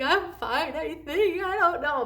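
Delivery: high, breaking voice of a crying mom; retold bawling. Mom tells pediatrician she's fine while bawling, voice breaking high. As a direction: push the words out through remembered tears. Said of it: "I just started bawling".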